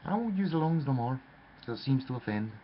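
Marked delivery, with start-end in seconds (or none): none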